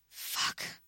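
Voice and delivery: breathy hissed curse; whispered hiss against her throat. A narrator's reading. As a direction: hiss it, barely voiced. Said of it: "she hissed".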